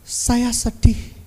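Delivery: ordinary delivery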